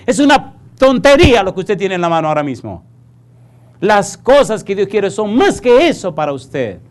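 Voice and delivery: loud, rising preacher's appeal; preaching at full force. Acted. Preacher urging the congregation to drop their junk, full force. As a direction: preach it hard, calling them to give it up.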